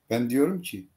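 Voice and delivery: usual speaking voice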